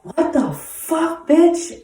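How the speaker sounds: high, drawn-out outraged yell; shocked yell. Retold or imitated. Yelling at a woman smoking drugs near kids, outraged. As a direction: yell it high and slow in disbelief.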